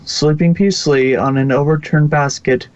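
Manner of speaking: ordinary delivery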